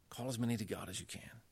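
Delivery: near-whispered, savoring the quote; hushed repeat. Retold or imitated. Lecturer repeating Calvin's language to his audience, hushed. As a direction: drop to a soft, intimate whisper.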